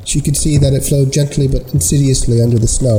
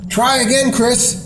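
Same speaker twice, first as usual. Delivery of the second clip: high urgent call across the ice; 11 semitones higher than usual. A narrator's reading. Wesley calling to Chris in the hole, high urgent cry. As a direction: shout encouragement up high.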